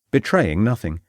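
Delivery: ordinary delivery, nothing marked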